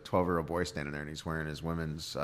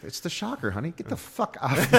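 mock angry wife; 11 semitones higher, 7 dB louder than usual. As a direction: yell it like the outraged wife. Husband and wife voiced in joke.

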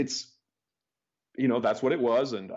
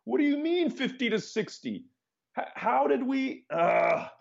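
high-pitched, drawn-out replay of losing it; retold outburst; 13 semitones higher than usual. Founder retelling his reaction to the banker's price, incredulous outburst. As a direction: re-enact losing it, pitch way up, stretching the words. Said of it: "that's when I lost my shit".

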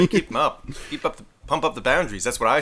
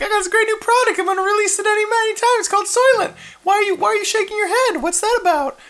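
much higher, loud pitchman voice; entrepreneur voice; 4 dB louder, faster than usual. Retold or imitated. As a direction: pitch your product to a skeptical grandpa. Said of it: "They're just like".

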